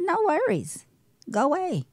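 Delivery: sarcastic retort to a friend's apology; sarcastic brush-off. Retold or imitated. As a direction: toss it back with a bite.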